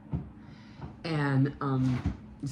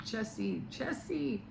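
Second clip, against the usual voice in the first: high, softened calling out; high-pitched, held-back mock yell; mock scream; 9 semitones higher, 5 dB quieter, slower than usual. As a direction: imitate screaming after someone without the volume. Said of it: "screamed out her name".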